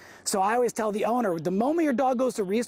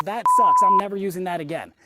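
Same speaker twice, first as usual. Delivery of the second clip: frustrated outburst; 7 dB louder than usual. Retold or imitated. Athlete after a bad fall, shouting in frustration. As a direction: blurt it out like you just fell on your ass. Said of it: "you go".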